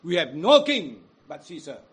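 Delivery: loud crowd cry; defiant mob cry. Acted. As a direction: shout it as the mob. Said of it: "what did they cry out".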